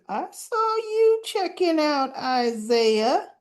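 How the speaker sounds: slow, high sing-song teasing; drawn-out mock "caught you"; teasing accusation. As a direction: imitate a friend catching someone out. Assistant teases wife in retelling.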